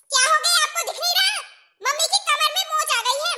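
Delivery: high-pitched, exasperated retort; indignant scolding. Acted. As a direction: scold someone who has missed the obvious. Scolding sister-in-law over mother's injury. (झुंझलाकर ऊँची आवाज़ में डाँटना)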